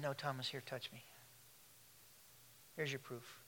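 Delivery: a gentle, hushed invitation; tender. Acted. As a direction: offer it softly, like holding out a hand. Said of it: "He, he's so tender".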